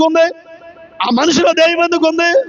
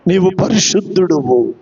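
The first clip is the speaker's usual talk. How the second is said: deep, low imitation of the spirit; low solemn voice for a retold cry; demon's cry retold; 10 semitones lower than usual. Preacher voices the spirit acknowledging holiness, low and solemn. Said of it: "పరిశుద్ధుడు అని కేకలేస్తుండే".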